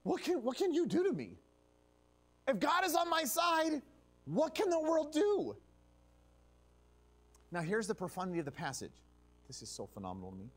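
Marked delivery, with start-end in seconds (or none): 0.0-5.6 rising preaching. Real, not acted. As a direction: lift it up like a rallying cry. Preaching climax.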